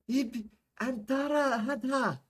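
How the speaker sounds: high, slow yell. Acted. Soldier yells to Omar over the sand, yelling. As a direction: shout it long across a sandstorm. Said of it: "the soldier yelled at him over the sand".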